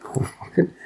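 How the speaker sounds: normal talking voice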